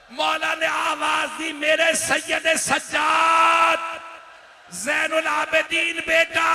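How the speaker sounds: high, loud, slow, half-sung cry; wailing plea. Acted. Zainab pleads and the Imam calls his son in a sung wail. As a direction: chant the lament out in grief.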